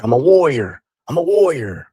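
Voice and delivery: loud, high proud shout of warrior; mock-proud warrior shout; proud declaration. Retelling his shaman ceremony.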